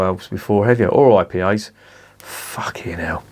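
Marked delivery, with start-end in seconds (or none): 2.2-3.2 muttered curse. Real, not acted. Exasperated aside after the rant.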